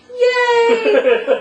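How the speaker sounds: high squealing yay through laughter; excited cheer. Retold or imitated. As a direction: cheer it like a kid. Quoting Tony's cheer.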